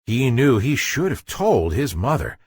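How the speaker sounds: plain reading voice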